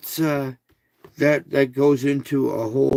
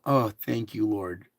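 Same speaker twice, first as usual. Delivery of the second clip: soft, lower relieved thanks; 4 semitones lower, 4 dB quieter than usual. Shown as a demonstration. A calmed person thanking the Lord, softer. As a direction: breathe out in relief. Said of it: "You notice you've calmed down".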